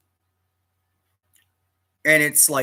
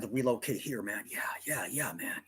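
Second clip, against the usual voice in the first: whisper; 7 dB quieter, faster than usual. Retold or imitated. Singer's voice drops mid-quote.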